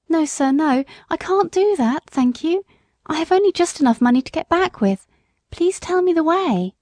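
high, anxious mother's voice; pleading country mother. A narrator's reading. Mother refusing a cab she cannot afford, high and pleading.

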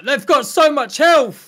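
loud drawn-out panic; panicked yell. Real, not acted. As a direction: shout it slow in alarm.

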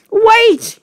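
very high, loud yelp; crying out. A narrator's reading. Flustered Wilfred to the Trunchbull, crying out. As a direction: cry out to stop the bully. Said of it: "he cried".

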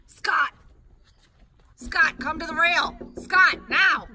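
loud urgent calling out; shouting a name. Real, not acted. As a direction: yell for him to come back. Calling friend away from bear.

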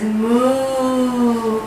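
long, drawn-out moo; slow moos with pauses between; cow mooing. Shown as a demonstration. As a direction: imitate a cow for a small child. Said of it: "The cow says".

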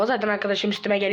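normal talking voice

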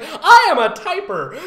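high, loud, slow laughing declaration; proud parody. Retold or imitated. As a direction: announce it like a grand self-discovery.

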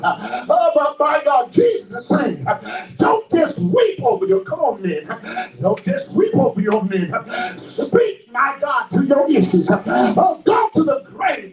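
high, driving sermon cry; fervent preacher with stretched words and gasped breaks; full-force preaching. Real, not acted. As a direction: preach it out in punched, rising phrases.

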